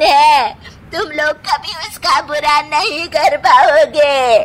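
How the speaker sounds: slow, whining, half-crying reproach; mother-in-law tearfully cursing her daughter-in-law; tearful mother-in-law lament. Acted. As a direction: wail at her as if deeply wronged.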